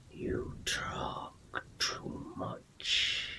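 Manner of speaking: very slow, whispered sinister sword spirit; gravelly voice. Acted. Shadowy sword spirit reproaches its wielder, gravelly whisper. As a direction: rasp it out slowly in a breathy growl. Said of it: "the gravelly voice calls out".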